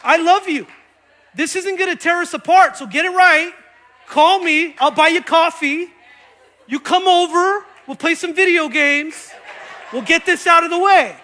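emphatic acted reconciliation. Pastor making peace with a slanderer, loud and insistent. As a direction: press it home loudly, insisting all is forgiven.

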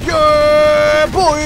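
loud, high whoop of triumph; victory cheer. Real, not acted. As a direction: celebrate at the top of your lungs. Victory cheer after big attack.

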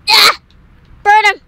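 short loud bursts; shouted attack order. Real, not acted. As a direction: yell it to set someone on the enemy. Yelling attack commands in game.

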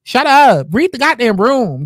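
normal talking voice

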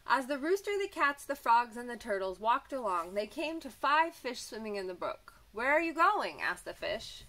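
bright, lively storybook voice; reading to children. A narrator's reading. Teacher reading a picture book to children, lively. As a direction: read it like a teacher to little kids.

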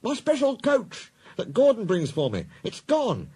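agitated little-engine voice, very high; high, flustered complaint; fussing. A narrator's reading. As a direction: fret urgently over something lost. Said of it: "Thomas came fussing".

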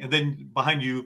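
ordinary talk

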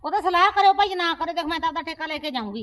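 aunt furiously demanding her due, loud and high; angry insistence. Acted. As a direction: shout her demand at the niece.

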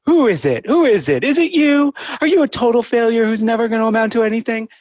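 put-on high, loud baby talk as if to a puppy; talking to a dog. Shown as a demonstration. Therapist demonstrating her negative thought as dog talk, high and loud. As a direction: coo at it like a pet dog, pitch way up. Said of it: "saying my thoughts as if I'm talking to a dog".

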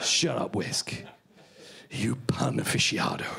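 low, half-whispered mock threat; low, hushed mock contempt; hushed menace. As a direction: tell off a punster under your breath. Tells off the whisk for pun.

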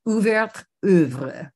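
slow, careful bad French accent. Shown as a demonstration. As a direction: sound out the title in a clumsy French accent. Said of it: "worst French accent".

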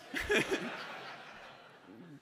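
breathless wheezing laugh. Real, not acted. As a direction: laugh at the punchline. Laughing at the story.

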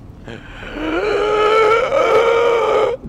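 long, very high loud squeal, building; swelling high screech; annoying squeal. Real, not acted. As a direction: let out a drawn-out piercing noise. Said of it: "that incredibly annoying sound".

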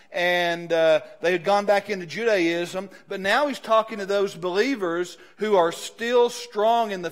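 loud, driving sermon voice; preaching at full force. Real, not acted. Pastor urging believers to move forward, loud. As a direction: push the church forward, full voice.

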